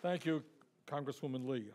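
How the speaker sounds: ordinary talk, nothing marked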